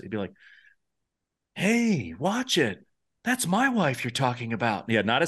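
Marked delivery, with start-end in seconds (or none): 1.5-4.9 high-pitched "hey, watch it", easing to normal; light warning without the gravitas; non-lower octave. Shown as a demonstration. Host demonstrates Paris's line undropped, non-lower octave. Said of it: "not as gravitas as when you lower it".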